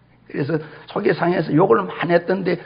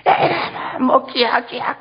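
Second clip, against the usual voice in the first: high, rough imitation of a scolding village mom; mock cursing mother; 10 semitones higher, much slower than usual. Preacher mimicking village mothers scolding, high mock curse. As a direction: ham up a Gyeongsang mother cursing her kids. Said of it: "이렇게 욕을 해요".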